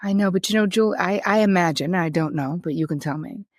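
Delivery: ordinary talk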